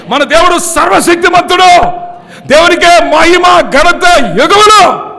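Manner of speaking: loud, high, drawn-out pulpit calls; preaching at full force. Acted. Pastor rallying the church to praise God, loud and high. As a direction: whip the congregation up with every phrase.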